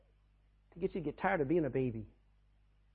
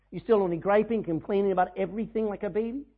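ordinary talk

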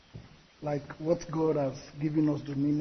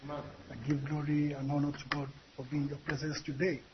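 ordinary talk, nothing marked

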